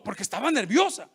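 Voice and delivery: ordinary delivery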